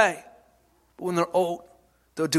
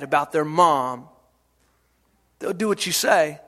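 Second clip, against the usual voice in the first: usual speaking voice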